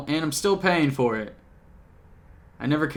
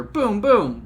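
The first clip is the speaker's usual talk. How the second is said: goofy boom boom; 5 semitones higher, 4 dB louder than usual. Pretend. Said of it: "why am I doing it again".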